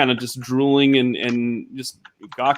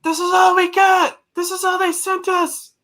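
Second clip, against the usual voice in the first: shouting back. Acted. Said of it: "Anna's like".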